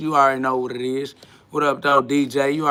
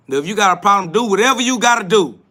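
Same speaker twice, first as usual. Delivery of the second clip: louder and higher, fed up; raised-voice dare; defiant shout; 5 semitones higher, 6 dB louder, faster than usual. Real, not acted. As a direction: throw the challenge back at him. Challenging a troll.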